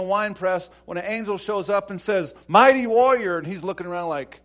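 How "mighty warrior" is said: ringing, much louder call of Mighty warrior; a booming heavenly greeting; angel's proclamation. Acted. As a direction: hail him like a herald angel. Angel hails hiding Gideon.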